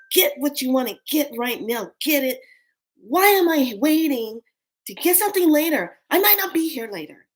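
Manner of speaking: heated, high-pitched urging; angry. Retold or imitated. Telling herself to buy it right now, angry. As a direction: raise the pitch and push, fed up with waiting. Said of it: "That's how angry I was".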